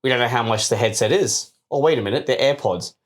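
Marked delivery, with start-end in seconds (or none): none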